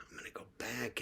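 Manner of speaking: voiceless, secretive whisper of a plan. Retold or imitated. Plotting to return to the smoke shop, whispered. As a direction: whisper it like a conspiracy.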